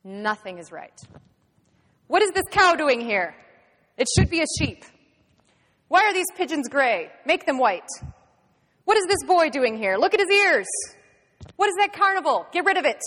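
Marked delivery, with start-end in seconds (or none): 2.1-13.0 loud, high barked complaints; fault-finding official shouting orders; angry inspector. Acted. Inspector finds fault with everything.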